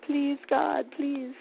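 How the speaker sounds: mild high-pitched plea to God; pleading. Acted. Speaker voicing a powerless prayer to God, pleading. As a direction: beg a little, as if praying for help. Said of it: "in a state of pleading".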